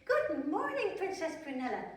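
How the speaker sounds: bright high sing-song hello; cheery princess greeting. Acted. Princess greeting her reflection, high and cheery. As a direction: greet yourself cheerfully in the mirror. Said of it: "in the big mirror to say".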